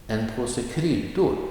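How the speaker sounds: kryddor lifted high with a swoop; light on En påse, lean on kryddor; stress on kryddor. Shown as a demonstration. Teacher models an example phrase, stress on kryddor. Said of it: "the noun will get the emphasis".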